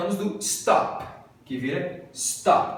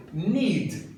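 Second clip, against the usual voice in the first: ordinary delivery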